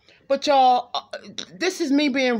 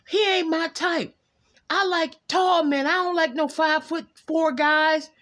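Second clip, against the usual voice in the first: voiced higher, sassy and picky; mock someone listing their type; picky person; 8 semitones higher than usual. Voicing the reading's picky person, higher and sassy.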